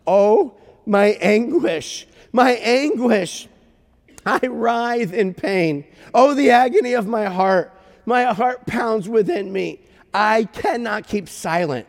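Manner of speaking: loud, high, slow cry of agony; God in anguish. Acted. Preacher voicing God's words in Jeremiah, anguished cry. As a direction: declaim it dramatically, drawn out and pained. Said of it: "God doesn't normally talk like that".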